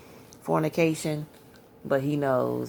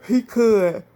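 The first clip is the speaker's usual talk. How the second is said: He could, thrown out much higher and louder; emphatic shout; 9 semitones higher, 9 dB louder than usual. Real, not acted. Insisting to viewers that God truly could. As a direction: hit it hard, as a preacher would. Emphatic repeat about God's power.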